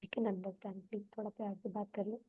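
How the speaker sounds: quiet reassuring plea; soft, at ease. Shown as a demonstration. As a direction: soothe someone who is shouting. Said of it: "जब तुम बात east में करो".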